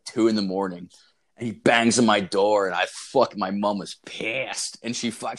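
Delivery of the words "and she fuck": usual speaking voice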